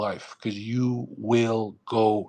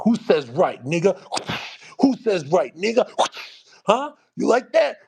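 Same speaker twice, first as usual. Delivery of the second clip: higher, louder taunting demand, escalating; mock whip-cracking master; 9 semitones higher, 4 dB louder than usual. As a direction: play a master shouting threats between whip cracks. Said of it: "We can just crack the whip".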